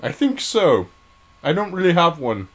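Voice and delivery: high-pitched, loud mock British mascot; Stuart. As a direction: answer in a high, put-on British accent. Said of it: "Can you do a British accent?".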